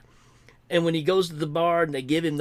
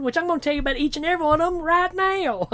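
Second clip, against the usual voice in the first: mock eager guide; 10 semitones higher, faster than usual. Mocking an overeager tour guide.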